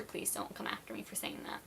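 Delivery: low, whispered nervous aside; whispered plea. Real, not acted. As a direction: whisper it, half joking.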